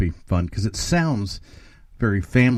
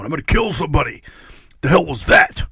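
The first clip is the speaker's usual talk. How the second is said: raised, higher mock-outraged metal fan; mock angry fan; 6 semitones higher, 7 dB louder, faster than usual. Podcaster mimicking furious skinless fans. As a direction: ham up an offended grind fan.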